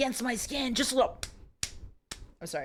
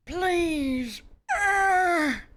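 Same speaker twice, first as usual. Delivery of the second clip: hammed-up desperate cry; mock cry; 5 semitones higher than usual. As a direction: wail it like the heroine. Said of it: "Oh, sorry, that's her".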